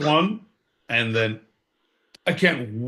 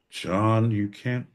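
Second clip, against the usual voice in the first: ordinary delivery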